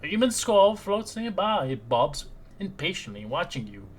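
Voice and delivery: storyteller painting a gloomy room; scene-setting narration. Acted. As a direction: describe the dungeon like a game master. Game master describes the scene.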